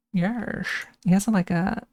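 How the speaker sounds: usual speaking voice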